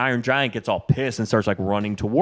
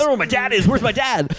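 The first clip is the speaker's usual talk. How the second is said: put-on very high panicky kid voice; mock freak-out; 13 semitones higher, 4 dB louder, faster than usual. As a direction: cry it out high in hammed-up panic. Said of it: "starts freaking the fuck out".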